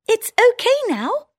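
high squeaky mouse, relieved; all clear in a tiny voice; town mouse voice. Acted. Town mouse telling her friend the danger's gone, high squeak. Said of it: "says the town mouse".

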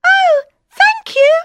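very high squeaky mouse pleading; tiny mouse voice. Acted. Mouse begs the lion not to eat him, squeaky. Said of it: "says the mouse".

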